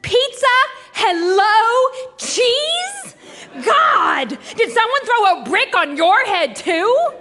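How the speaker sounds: high, incredulous one-word questions building to an outburst; fed-up, mocking incredulity; exasperated disbelief. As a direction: throw each word at the idiot. Exasperated at his dumb offer.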